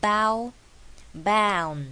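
BOW, BOUND drawn out with a full "ow" like 凹; 凹的音. Shown as a demonstration. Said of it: "凹这个声音是一模一样的". (bow、bound的ow念成凹)

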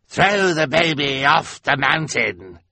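villain's cold order; ordering Olaf. A narrator's reading. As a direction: order it coldly. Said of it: "he ordered".

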